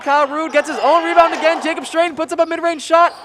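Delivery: high, loud rapid-fire game call; excited play-by-play. Real, not acted. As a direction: call the fast break live. Live play-by-play continues.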